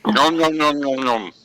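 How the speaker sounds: squeezed-cheek munching voice; squished cheeks. Acted. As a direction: talk with the cheeks squeezed together. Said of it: "I'm just squeezing my cheeks together".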